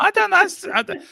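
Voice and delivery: high squeal of laughter, then words through it; laughing. Real, not acted. Co-host laughing at the name, high-pitched giggle.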